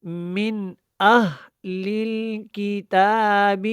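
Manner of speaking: measured tajweed demonstration; careful Quran recitation. Shown as a demonstration. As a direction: recite slowly, no vowel after the h of اہل. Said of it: "اس کو اہلل نہیں پڑھنا".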